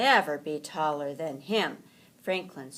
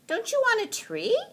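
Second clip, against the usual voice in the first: put-on bright young turtle voice, quick and high; Franklin asking, higher voice; 6 dB louder, faster than usual. A narrator's reading. Franklin asks Rabbit about the trees, high and quick. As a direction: ask Rabbit eagerly, pitched up. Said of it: "Franklin asked".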